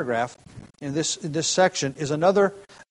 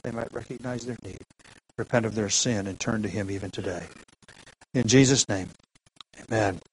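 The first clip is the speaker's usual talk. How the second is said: hushed, lower prayer voice; 4 semitones lower than usual. Acted. Pastor prays before the sermon.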